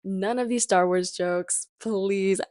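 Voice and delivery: usual speaking voice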